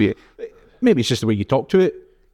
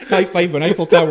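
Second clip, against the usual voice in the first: laughing; 7 semitones higher, 3 dB louder than usual. Real, not acted. Laughing joke.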